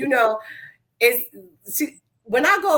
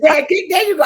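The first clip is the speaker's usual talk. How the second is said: higher and louder, egging her on; cheering shout; 9 semitones higher, 6 dB louder than usual. Real, not acted. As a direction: shout encouragement across the table. Cheering a friend's offer.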